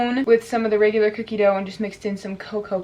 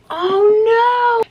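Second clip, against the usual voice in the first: surprised; 13 semitones higher, 8 dB louder than usual. Real, not acted. Something goes wrong on camera.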